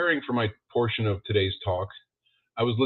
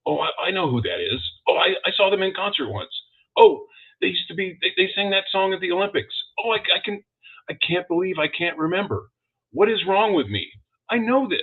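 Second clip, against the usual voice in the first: higher-pitched, frantic self-questioning; 8 semitones higher than usual. Retold or imitated. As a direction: fret aloud, racing to recall a forgotten song. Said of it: "when I'm in a frantic mode like that".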